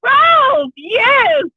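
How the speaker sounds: excited shout. Real, not acted. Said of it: "the Chipmunk".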